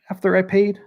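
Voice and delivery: ordinary talk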